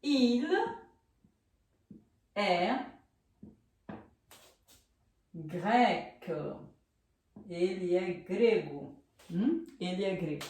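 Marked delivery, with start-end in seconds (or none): 0.0-6.3 slow dictation. Shown as a demonstration. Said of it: "aqui a gente vai escrever".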